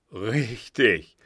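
a light laugh through the word; old man chuckles as he agrees. A narrator's reading. Old man to his son, laughing. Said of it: "lachte der Alte".

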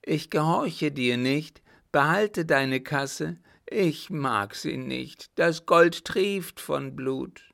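high, slow, anguished refusal; defiant madman. Acted. Mad son refusing the blood money, defiant.

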